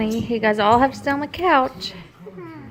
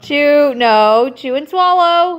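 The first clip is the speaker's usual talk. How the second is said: louder, higher, insistent; firm loud command; 5 semitones higher, 6 dB louder, much slower than usual. Real, not acted. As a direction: insist loudly, spell it out. Mom insisting over protests.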